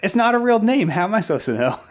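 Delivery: high, loud, amused self-defence; laughing protest. Real, not acted. Co-host defending her childhood mispronunciation, laughing in protest. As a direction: protest loudly through real laughter, voice shooting up.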